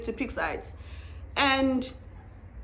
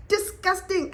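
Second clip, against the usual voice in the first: ordinary talk, nothing marked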